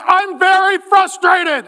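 high, drawn-out exasperation; over-the-top frustration, pitched high; mock frustrated complaint. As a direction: whine it slowly up to God, hammed up. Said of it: "He comes to God".

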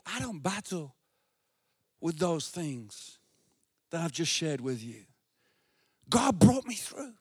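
soft, low, intimate preaching; quiet sermon. Acted. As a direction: drop to a hush.

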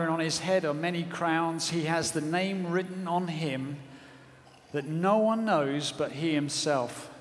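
solemn scripture reading. Acted. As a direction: read it with hushed awe.

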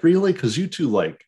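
high, loud disbelieving outburst; incredulous. Retold or imitated. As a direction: pitch way up in mock disbelief. Acts out his disbelieving reaction.

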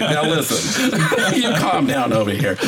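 joking reprimand, laughter breaking in; laughing mock scolding. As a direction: pretend to scold while cracking up.

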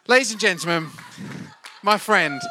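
loud, lifted announcer build-up; announcer intro. Acted. Host announcing his friend to the church, loud announcer style. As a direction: present him like a showman.

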